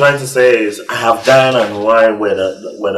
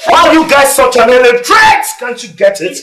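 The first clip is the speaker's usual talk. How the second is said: high exasperated yell; angry shout; 12 semitones higher than usual. Acted. Scolding the class.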